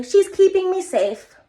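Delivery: put-on a child's reassured voice. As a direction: speak it like a small child would. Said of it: "like, they say".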